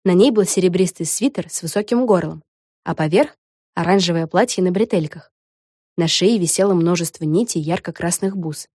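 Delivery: ordinary delivery, nothing marked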